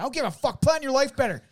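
very high, exasperated yell; retold outraged scolding; angry retort. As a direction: fire back at a neighbour's excuse.

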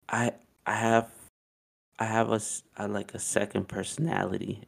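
soft, hesitant, trailing confession; a girl shyly admitting a secret; hushed confession. Retold or imitated. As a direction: confess it quietly, almost ashamed.

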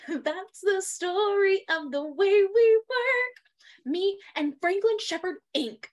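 high, slow sung Daniel Radcliffe imitation; Daniel Radcliffe impression. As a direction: croon it like Daniel Radcliffe in a musical. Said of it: "That was a really good Daniel Radcliffe".

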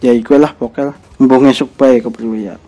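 ordinary talk, nothing marked

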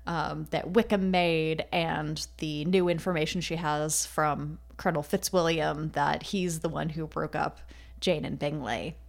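ordinary delivery

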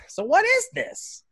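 put-on high, slow old lady question; grandma voice. Grandmother asking her grandson about the match, high and slow. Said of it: "my grandma's like".

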